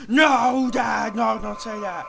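mock panicked shout. Son shouting at dad to stop blaspheming, mock alarm. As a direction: shout him down like a scandalised child.

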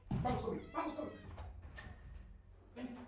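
very soft whisper to a pet; whispered coaxing. Real, not acted. Streamer whispers to his pet away from the mic. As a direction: coax the pet in a hush.

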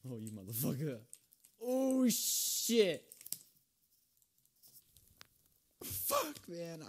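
screaming out in rage; angry yelling. Real, not acted. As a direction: yell it, furious at the game. Rages at the game.

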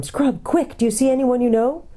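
ordinary delivery

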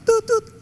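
imitating phone beep. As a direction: make a hold tone with your mouth.

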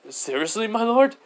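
mild astonished question; incredulous. Acted. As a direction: ask it amazed, jumping up at the end. Goblin incredulous at offered help.

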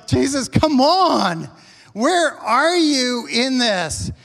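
loud, drawn-out plea; high, slow, pleading complaint; exasperated appeal. Acted. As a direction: call out impatiently for help. Acted exasperated appeal to Jesus.

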